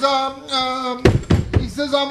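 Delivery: usual speaking voice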